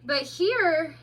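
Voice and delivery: high, slow, dreamy voice; drowsy otter. A narrator's reading. Tipsy otter answering the mole, dreamy. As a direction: drift off mid-sentence.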